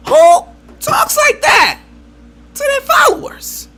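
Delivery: high, drawn-out exclamation; outraged disbelief. Real, not acted. Vlogger aghast at how followers get spoken to, outraged. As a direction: cry it out slowly, incredulous.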